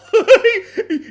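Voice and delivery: loud, high-pitched burst of laughter. Real, not acted. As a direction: crack up suddenly. Laughing at the fork grip.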